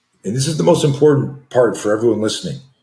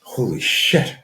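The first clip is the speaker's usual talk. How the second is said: higher, nervous mutter to oneself; retold anxious dread; 4 semitones higher, slower than usual. Pacing the kitchen before class starts, mildly panicked. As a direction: act out pre-class jitters.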